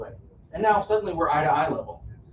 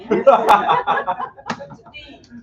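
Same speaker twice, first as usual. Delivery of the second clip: hearty laugh; 8 semitones higher, 7 dB louder than usual. Real, not acted. Speaker laughs at own joke.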